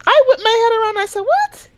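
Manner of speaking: very high, squeaky-pitched disbelief; shocked double take. Retold or imitated. Hearing coworker's mangled French, very high shocked reaction. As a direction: shoot way up in mock shock.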